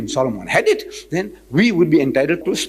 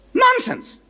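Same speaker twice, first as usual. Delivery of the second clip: scoffing. Real, not acted. Scoffing at colonial claims.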